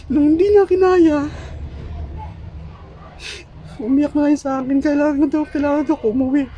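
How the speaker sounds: sobbing while speaking; high, tearful, breaking voice; crying. Real, not acted. Grieving husband recounts wife's death.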